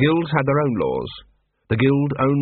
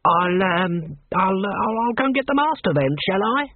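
high, hesitant, intimidated assassin; cowed, faltering offer; nervous backing down; 6 semitones higher than usual. A narrator's reading. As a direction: stammer meekly as your pitch climbs. Intimidated assassin backs down before guards.

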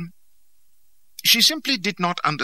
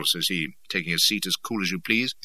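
plain reading voice

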